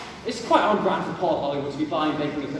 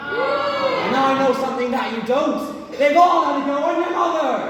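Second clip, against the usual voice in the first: a pirate captain's gloating shout, higher and louder; 7 semitones higher, 4 dB louder than usual. Acted. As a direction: bellow the punchline at Jack. Poem's punchline shouted.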